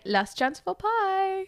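high, slow sing-out offer; mock-sinister host. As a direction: draw it out in a high comic character voice. Voices the German man offering pie.

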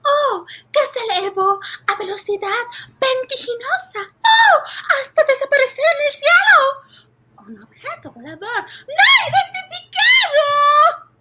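breathless, rising excitement; excited. Acted. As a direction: blurt it out in awe. Excited report of UFO sighting.

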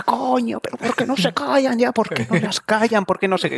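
very high-pitched comic rant at normal volume; mock exasperated yelling. Neighbour yelling at home about noisy children, mock exasperation. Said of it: "dando gritos en mi casa".

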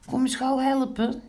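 high, urgent storybook call; calling for help. A narrator's reading. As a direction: call a friend over for help. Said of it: "riep Pinkeltje". (hoog om hulp roepend)

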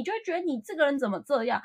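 usual speaking voice

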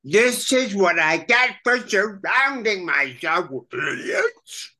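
high Krang voice, idiots cracking into a wail. Acted. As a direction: whine it like an exasperated alien brain. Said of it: "let me see if you can get this".

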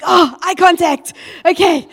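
startled into a too-bright hi; playful awkward panic; flustered nervous. Retold or imitated. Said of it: "I'm like".

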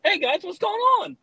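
mock cheerful greeting. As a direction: greet the room way too brightly. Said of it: "you're not gonna be like".

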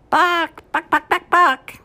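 loud, high clucking buck buck; chicken impression. Grand marshal clucking to break concentration, chicken. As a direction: cluck like a hen to distract. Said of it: "doing a chicken impression".

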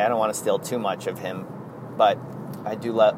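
ordinary talk, nothing marked

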